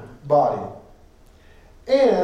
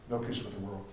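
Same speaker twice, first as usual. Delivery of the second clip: much lower, much softer repeat of "No peace with the world"; hushed echo; 8 semitones lower, 13 dB quieter, faster than usual. Real, not acted. Preacher echoing the line to the congregation, low and hushed. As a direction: drop to a near-murmur for the repeat.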